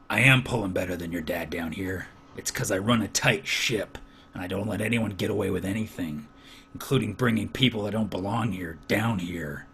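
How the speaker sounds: low, soft, scolding foreman voice; stern foreman. Acted. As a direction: scold him quietly from low in the chest. Foreman retorts to Durgan.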